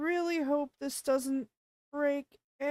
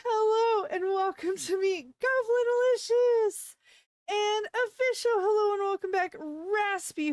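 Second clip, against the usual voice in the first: loud, high showtime welcome to the viewers; stream intro; 7 semitones higher, 5 dB louder than usual. Real, not acted. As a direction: announce the stream opening with full hype. Streamer's signature opening greeting.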